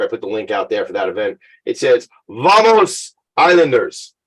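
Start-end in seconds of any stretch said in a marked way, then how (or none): 2.3-4.1 high, drawn-out mock Spanish-accented cheer; playful put-on Spanish accent. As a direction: call it out slowly in a hammed-up Spanish accent. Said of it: "That's my little accent".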